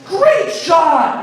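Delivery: high, delighted cheer for a great hit; excited cheer. Retold or imitated. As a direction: cheer like a proud sports dad. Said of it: "my first thought was like".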